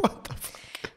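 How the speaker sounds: whispered disbelief. Real, not acted.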